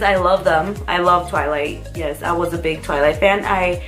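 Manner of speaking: normal talking voice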